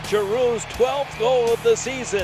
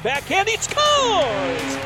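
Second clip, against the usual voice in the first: slow, stretched goal call, higher; drawn-out goal shout; 6 semitones higher, 3 dB louder, much slower than usual. Real, not acted. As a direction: drag out he scores with excitement.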